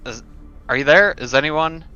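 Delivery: normal talking voice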